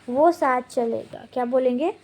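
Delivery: ordinary delivery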